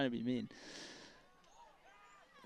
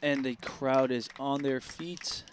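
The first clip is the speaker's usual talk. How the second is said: building broadcaster excitement, louder; play-by-play; 5 semitones higher, 9 dB louder than usual. Acted. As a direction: call the big moment for the team. Live play-by-play call.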